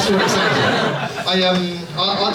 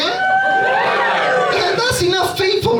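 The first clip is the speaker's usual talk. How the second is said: mock cheer; much slower than usual. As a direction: whoop it up sarcastically. Mocking a sarcastic crowd cheer.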